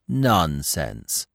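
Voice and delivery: mildly scornful dismissal; a touch of scorn, understated. A narrator's reading. Gobo brushing off Bambi's warning, scornful. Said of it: "said Gobo scornfully".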